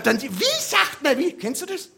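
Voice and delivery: ordinary talk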